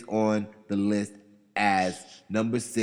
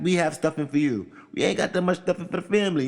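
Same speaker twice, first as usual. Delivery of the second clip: higher, louder, quicker voicing of the family; mock family protest; 8 semitones higher, 3 dB louder than usual. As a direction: play the family refusing neighbours, hammed up. Family turning away stuffing seekers.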